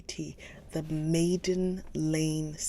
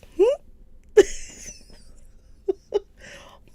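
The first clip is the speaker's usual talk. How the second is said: high burst of laughter; 10 dB louder than usual. Real, not acted. Laughing at her own British take.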